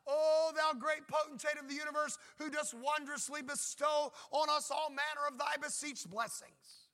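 loud, lofty mock-Elizabethan prayer; pompous King James prayer. Mocking a churchgoer who addresses God in flowery language, loud and pompous. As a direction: ham up an ornate old-English prayer to God. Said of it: "start addressing God".

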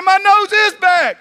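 high, loud overjoyed cry; excited Jethro. Acted. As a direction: burst out in disbelieving joy. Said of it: "And he's so excited".

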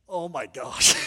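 loud, appalled reaction; shocked exclamation. Retold or imitated. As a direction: burst out in horrified disgust. Acted shocked reaction to vomit.

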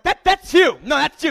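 gleeful accusation; excited accusing. Retold or imitated. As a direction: point the finger, thrilled to catch him out. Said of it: "And like".

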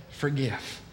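low, soft, solemn single word; a soft, low Forgive; hushed command. Retold or imitated. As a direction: say forgive quietly, as from God. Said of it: "He says".

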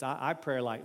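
ordinary delivery

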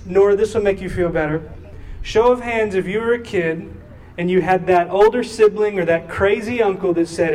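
ordinary talk

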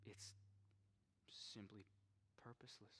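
fading whisper. Real, not acted. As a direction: let it fade to nothing. Trailing whisper.

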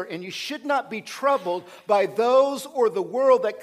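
impassioned pulpit delivery, driving each clause; fervent preaching. Acted. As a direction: preach it out, rising with conviction. Preaching at full force.

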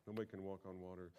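fast, dropped repeat of "Nobody can walk on water". Shown as a demonstration. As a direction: toss it off quickly. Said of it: "they know it's impo".

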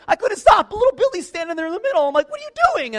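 high, loud, frantic retelling of a crash; frantic yelling. Retold or imitated. Snowboarder reliving his crash into a kid on the slope, frantic yelling. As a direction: cry out at the top of your range in mock alarm.

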